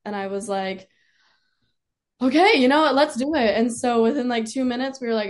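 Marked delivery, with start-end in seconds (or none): 2.2-3.6 loud, raised-pitch burst of resolve; decisive agreement. Retold or imitated. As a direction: retell a sudden bold yes, loud and high. Retold bold decision to sign.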